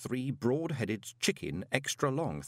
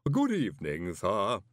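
deferential servant in a put-on voice; formal, measured Igor greeting; Igor manservant. A narrator's reading. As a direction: greet the guest as a creepy butler. Igor servant greets visitor at door.

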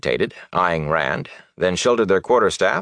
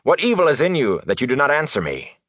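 high, demanding question; snapped accusation; 8 semitones higher than usual. A narrator's reading. Officer interrogating silent Rand, sharp accusation. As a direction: demand an answer, raised in pitch. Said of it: "Bornhold snapped".

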